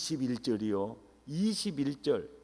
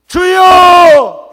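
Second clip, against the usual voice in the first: high, long drawn-out bellow; pleading shout; 27 dB louder, much slower than usual. Acted. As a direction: cry out to the Lord at full force. Repeats Peter's cry to Jesus. (주님을 부르며 길게 절규)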